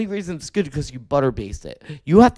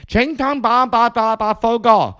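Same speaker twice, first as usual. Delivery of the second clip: mock Vietnamese; 6 semitones higher, 5 dB louder, slower than usual. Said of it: "She's like".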